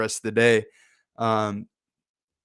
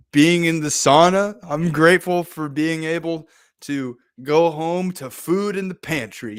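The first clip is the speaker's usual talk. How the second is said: higher, slow, playful listing of thanks; 5 semitones higher, 4 dB louder than usual. Acted. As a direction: draw out each thing playfully. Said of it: "you're kind of playful".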